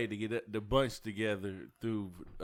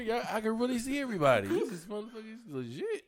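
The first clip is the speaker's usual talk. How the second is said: high-pitched, louder marvelling; mock amazement; 10 semitones higher, 4 dB louder, faster than usual. Imitating a friend discovering group video, high amazed voice. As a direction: play an astonished newcomer to video calls.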